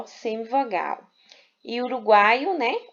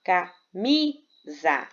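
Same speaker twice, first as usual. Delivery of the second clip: camisa very slowly split as ca-MI-sa; stress on penultimate syllable; much slower than usual. Shown as a demonstration. Teacher giving a paroxytone example, camisa syllable by syllable with stressed MI. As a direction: say camisa in three beats, leaning on MI. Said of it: "a penúltima sílaba é a sílaba mais forte".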